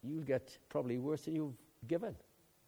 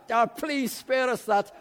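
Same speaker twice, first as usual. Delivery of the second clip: mock exasperation; 9 semitones higher, 11 dB louder, slower than usual. Mock disgust at an explicit topic.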